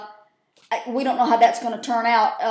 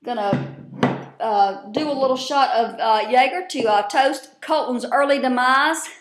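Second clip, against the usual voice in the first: ordinary delivery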